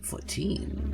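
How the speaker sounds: slow whispered aside; whispering. Real, not acted. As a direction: whisper the rating. Whispered aside about rating.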